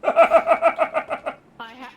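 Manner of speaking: bursting into laughter; laughing. Real, not acted. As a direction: laugh through the words. Laughing outburst.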